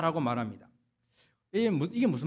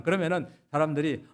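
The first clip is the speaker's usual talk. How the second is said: normal talking voice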